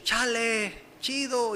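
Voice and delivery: chale alargado, leve imitación de pandillero; habla de pandillero. Shown as a demonstration. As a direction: di la jerga callejera estirándola un poco. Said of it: "hablar como pandillero". (hablar como pandillero, arrastrando un poco)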